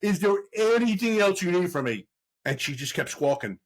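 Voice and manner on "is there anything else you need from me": cop losing patience with lady; exasperated. Retold or imitated. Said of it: "I'm like".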